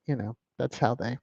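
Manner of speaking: normal talking voice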